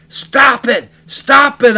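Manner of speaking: high, loud, rising protest; acted cry. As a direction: beg him to stop through the fumes. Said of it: "I cried".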